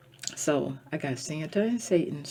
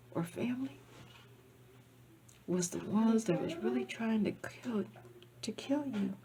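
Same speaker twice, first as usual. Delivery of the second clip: hushed warning; 4 semitones higher, 5 dB quieter than usual. Real, not acted. Ominous warning about false friends.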